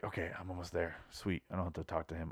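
very quiet, low self-talk; hushed private aside; muttered inner thought. Retold or imitated. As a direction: think it to yourself under your breath. Retold inner thought while sneaking away.

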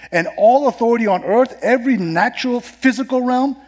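loud, high, emphatic pulpit voice; building sermon, swelling at each phrase; forceful preaching. Acted. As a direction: proclaim it to the whole congregation, stressing key words.

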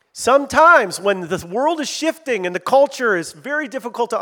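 full-force preaching. Acted. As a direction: call the church to action from the pulpit.

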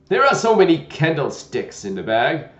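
put-on loud, gentle old knight; a kindly, bumbling old knight. Knight voiced in story.

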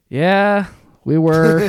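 cheeky retold admission of guilt; loud proud confession. Cheerfully admitting balloon throwing to cop.

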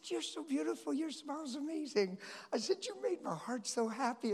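put-on high, sweet compliments to a little girl; cooing to a child. Speaker sweetly praising a toddler, high cooing voice. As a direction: melt over her smile.

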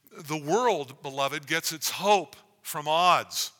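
fervent full-voiced sermon; preaching.

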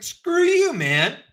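hammed-up outrage; mock angry retort. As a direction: fling it back furiously.

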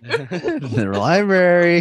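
high, very slow, laughing; laughing high drawl. Pretend. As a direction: stretch it out high through laughter. Echoing with laugh.

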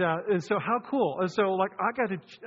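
ordinary talk

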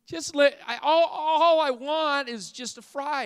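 high, hammed-up whining dad; comically wheedling father, voice pushed up high; pleading dad. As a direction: plead with your daughter for one little fry. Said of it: "To which the dad says".

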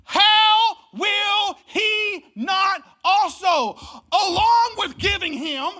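slow, high, shouted proclamation; preacher booming the verse to the church; shouted Bible reading. Shown as a demonstration. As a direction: proclaim every word slowly at full voice. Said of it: "y'all reading this Bible?".

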